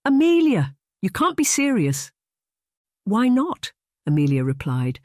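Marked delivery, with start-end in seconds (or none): 0.0-2.1 high-pitched, disbelieving exclamation; scandalised friend, voice leaping high; wide-eyed shock. A narrator's reading. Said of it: "Evelyn's eyes widened". 3.0-3.7 high, airy comeback; breezy, high-voiced question; light tone. A narrator's reading. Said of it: "her tone light".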